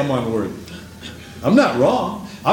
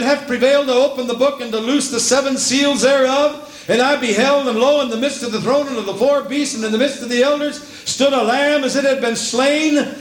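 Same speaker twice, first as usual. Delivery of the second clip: higher, fervent sermon climax; preaching at full force; 9 semitones higher, slower than usual. Acted. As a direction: build the refrain weep not to a shout.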